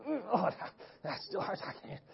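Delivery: weak, sinking voice, trailing to almost nothing; small, soft voice of a hurt kid; pained murmur. Retold or imitated. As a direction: act out hurting, barely getting it out. Acts hurt player trying to stand.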